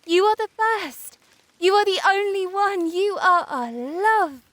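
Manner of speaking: high, delighted exclamations of admiration; rapturous praise. A narrator's reading. Sunbeams promise the first flower summer companions, joyful and admiring.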